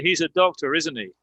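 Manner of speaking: tag "isn't he" drops, just confirming; falling intonation. Shown as a demonstration. Teacher showing students a confirmation check, falling intonation on "isn't he". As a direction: let "isn't he" fall like a statement. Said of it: "I'm just confirming it".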